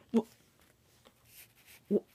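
ordinary talk, nothing marked

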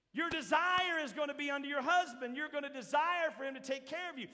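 loud, high, rousing sermon shout; fiery preaching. Acted. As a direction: hammer the point home to the congregation. Preaching at full force.

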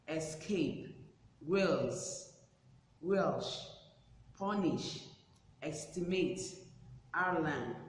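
each word said singly with a falling tune and a pause after; careful word-list pronunciation. Shown as a demonstration. Teacher models the page's words for pupils, careful pronunciation. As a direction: pronounce each listed word distinctly, pausing between. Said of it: "listen carefully as I pronounce these words".

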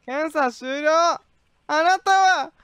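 slow, high announcement; stern official. Acted. Inspector denying a traveler entry, slow stern announcement. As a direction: drag out the verdict like an inspector.

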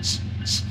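whispered ch ch train sound; train chugging. Acted. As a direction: chug like a toy train. Train noise in children's song.

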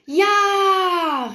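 high, loud, long-held cheer; joyful cry. A narrator's reading. Two groupies cry out together, high drawn-out cheer. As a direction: stretch it into an eager squeal. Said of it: "riefen sie unisono".

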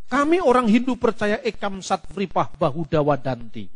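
normal talking voice

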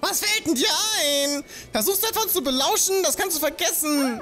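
put-on high, indignant scolding old man; grumpy old man. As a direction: tell off the nosy youngster. Voices a scolding old villager.

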